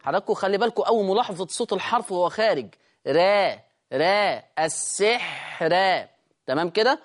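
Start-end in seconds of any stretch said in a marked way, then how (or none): 3.1-4.4 را said light and thin, not heavy; slow light را. Shown as a demonstration. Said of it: "أنا عايز أسمعها را". 4.6-6.1 السحر drawn out slowly with a light ra; slow السحر, ra softened; thin ra. Shown as a demonstration. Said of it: "أنا عايز أسمعها را".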